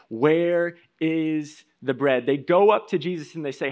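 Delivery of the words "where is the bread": slow demanding. Retold or imitated. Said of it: "they ask the question".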